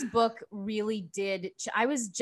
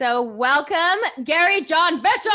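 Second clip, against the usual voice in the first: announcer welcome; 8 semitones higher, 9 dB louder than usual. Acted. Podcast host welcoming guest Gary John Bishop with booming fanfare. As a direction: drag out the name with big fanfare.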